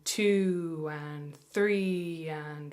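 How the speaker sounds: very slow, drawn-out counting in time. Shown as a demonstration. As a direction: count each beat slowly. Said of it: "Here we go".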